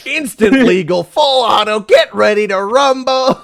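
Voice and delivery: loud, high announcer hype; monster truck announcer. Acted. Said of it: "It is actually Monster Truck Announcer".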